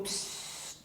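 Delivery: soft breathy "psh"; whispered call for silence; shushing. Shown as a demonstration. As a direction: hush someone with a long hiss. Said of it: "Pedido de silêncio".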